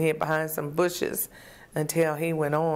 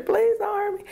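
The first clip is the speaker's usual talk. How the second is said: screaming plea. Retold or imitated. Begging the knife-wielding driver, high retold plea. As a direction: beg for your life, high and desperate. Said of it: "I'm hollering and I'm screaming".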